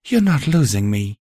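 a tender mare soothing. A narrator's reading. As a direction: soothe him, promise you stay. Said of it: "Velvet soothed".

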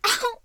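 high, loud "ow"; yelp. Acted. Woman reacts to her partner, high yelp. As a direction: yelp out, pitch jumping up. Cries out in play.

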